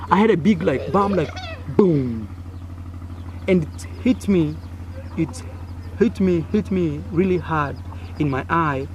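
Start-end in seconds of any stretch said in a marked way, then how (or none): none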